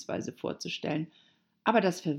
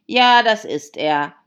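loud, drawn-out sighing reply; slow, higher lament; 6 semitones higher, 14 dB louder than usual. A narrator's reading. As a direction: heave it out with a sigh. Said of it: "sagte der Hutmacher seufzend".

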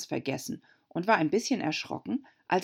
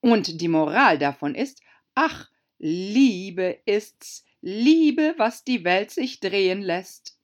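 slow, louder swooning duchess with a soaring Liebe; Herzogin, schwärmerisch; 3 semitones higher, 6 dB louder, slower than usual. A narrator's reading. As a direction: sigh out the moral theatrically. Herzogin verkündet Moral. (Herzogin verkündet schwärmerisch, langsam, Liebe hoch und laut)

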